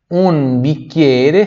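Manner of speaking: "bicchiere" whispered, slow, hard k. Shown as a demonstration. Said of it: "C H I som de chi".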